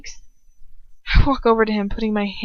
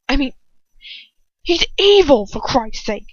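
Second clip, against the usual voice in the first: sudden high, loud outburst; bursting out; 5 semitones higher, 6 dB louder than usual. A narrator's reading. Ron bursting out at Hermione over Lucius, loud outburst. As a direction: explode at her. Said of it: "he bursts out".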